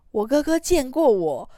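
re-living the moment it clicked; gasping amazement. Retold or imitated. Girl realising her brother met her as a baby, gasping in amazement. Inner thought on brother's remark.

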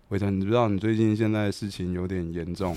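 hushed, grave warning; quiet ominous warning. Retold or imitated. Warning Weiteng his situation is serious, hushed and grave. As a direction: drop the voice and let the threat hang.

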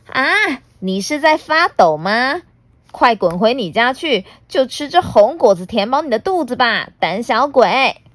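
mock him as a coward; mocking villain. Devil dragon calling T-rex a coward, sneering.

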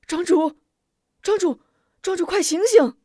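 high urgent calling. Acted. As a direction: call him to wake up, rising alarm. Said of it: "呼唤". (焦急高声呼唤)

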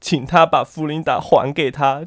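ordinary talk

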